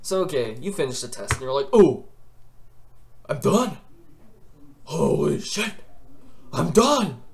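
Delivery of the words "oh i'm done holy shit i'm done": excited relief. Retold or imitated. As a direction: celebrate finishing like a weight lifted. Relief after finishing test.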